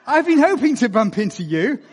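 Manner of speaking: lightly voiced Obama, chuckling through it; a friendly greeting broken by laughs; Obama laughing. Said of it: "He said".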